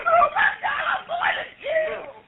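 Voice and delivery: high, crying outburst of panic; panicked crying. Real, not acted. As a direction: cry out in real terror. Panicked caller at the scene.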